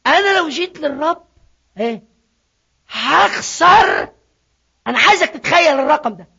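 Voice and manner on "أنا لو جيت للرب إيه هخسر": drawn-out, half-shouted "I'll lose"; fearful man. Pretend. Preacher voicing a frightened believer, drawn-out cry. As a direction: stretch the word out like a man panicking over money.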